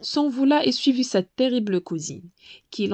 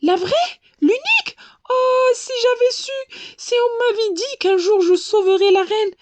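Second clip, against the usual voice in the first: thrilled; 12 semitones higher, 4 dB louder than usual. A narrator's reading. Butterfly thrilled at meeting queen.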